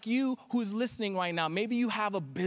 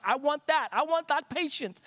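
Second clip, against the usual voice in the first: excited, higher-pitched exclamation; eager wanting; 7 semitones higher than usual. Retold or imitated. Acts out his eager younger self.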